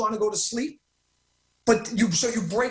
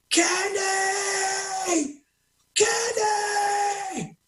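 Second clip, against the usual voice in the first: long, much higher shouted name, drawn out; reenacted scream; 8 semitones higher, much slower than usual. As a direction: call the friend's name like you've been shot. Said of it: "I started screaming".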